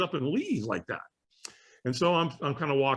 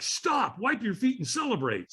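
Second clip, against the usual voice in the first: high-pitched imitated command; urgent catchers; 7 semitones higher than usual. Catchers at end of fire lane, urgent call. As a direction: call out to halt someone.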